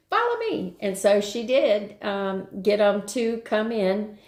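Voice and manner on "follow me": high-pitched dog calling follow me; Lassie voice. Retold or imitated. Sitter voicing the little shepherd like Lassie, very high. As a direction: squeak it out like Lassie. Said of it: "like Lassie".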